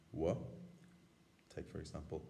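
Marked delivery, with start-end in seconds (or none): 0.1-0.3 broad M H said as wa; w sound. Shown as a demonstration. Teacher showing how the broad M H is said. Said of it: "pronounce it as".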